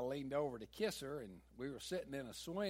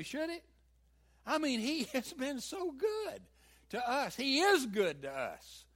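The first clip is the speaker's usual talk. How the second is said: preaching at full force; 8 semitones higher, 11 dB louder than usual. Real, not acted. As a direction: build the sermon to a peak. Preaching climax.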